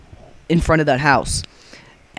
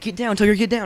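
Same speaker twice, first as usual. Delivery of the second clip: higher-pitched hushed warning; urgent hushed call; 6 semitones higher than usual. Retold or imitated. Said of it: "they're like".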